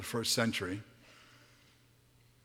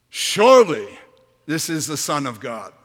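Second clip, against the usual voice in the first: exclaimed; 4 semitones higher, 12 dB louder than usual. Acted. Centurion at the cross crying out, loud. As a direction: Surely burst out high and loud. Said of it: "and exclaimed".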